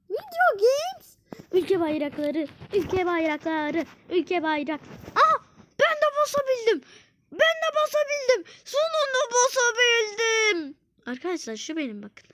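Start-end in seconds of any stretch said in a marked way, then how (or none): 0.1-1.2 high, slow sing-song. Acted. Gamer lilting the category name. 5.2-10.8 high, fast joyful cry; excited. Real, not acted. Gamer cheering after finally pressing it.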